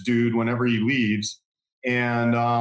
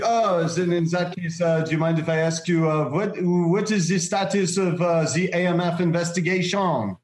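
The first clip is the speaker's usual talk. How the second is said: put-on higher-pitched French accent, hesitant and probing; 4 semitones higher than usual. Fake reporter asking about an investigation, in French accent. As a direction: imitate a Frenchman politely fishing for information. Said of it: "a very distinct French accent".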